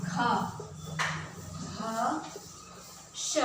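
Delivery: each sound "ख", "भ", "श" said alone and clearly with pauses; sounding out letters. Shown as a demonstration. Teacher to class, sounding out "ख", "भ", "श" one at a time. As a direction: spell out "ख", "भ", "श" one by one, slowly. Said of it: "उसकी ध्वनि कुछ इस प्रकार होगी".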